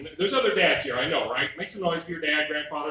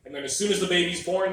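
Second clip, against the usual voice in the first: normal talking voice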